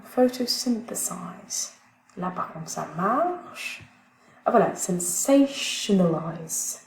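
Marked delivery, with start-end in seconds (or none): 0.0-1.7 "Photosynthesize" said slowly, stress on "-syn-"; slow stress demo. Shown as a demonstration. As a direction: model "Photosynthesize", leaning on "-syn-". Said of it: "teacher models word stress". ("Photosynthesize" lentement, accent sur "-syn-")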